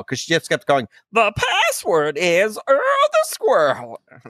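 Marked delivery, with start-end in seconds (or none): 1.1-4.0 put-on high, drawn-out old-time character voice; nineteen-twenties voice. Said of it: "do this nineteen twenties voice".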